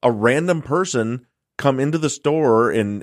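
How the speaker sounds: high, heated retold yell at customer service; reliving the outrage, voice up high. Yelling at the phone company rep, high-pitched angry outburst. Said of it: "Yeah, I'm yelling at him".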